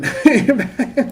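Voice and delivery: high, loud burst of laughter; real laugh bursting out; amused laugh. Real, not acted. Streamer gives up on the Spanish lesson, bursts out laughing.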